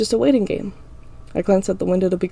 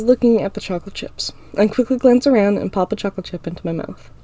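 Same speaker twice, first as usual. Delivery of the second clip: ordinary delivery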